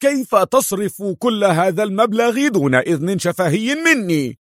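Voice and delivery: high, panicked protest; high-voiced outraged alarm; horrified cry. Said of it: "شحب وجه آلان وصاح".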